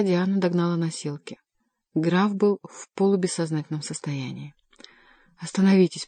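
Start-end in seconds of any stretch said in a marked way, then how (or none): none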